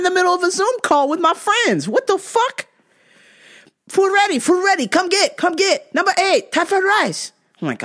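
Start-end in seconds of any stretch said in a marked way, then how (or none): 1.9-2.7 high, sharp disbelief; outraged. Real, not acted. Interrupted during wrestling. 3.9-7.3 high, clipped imitation of the Thai restaurant clerk's accent; mock Thai accent. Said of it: "they call me".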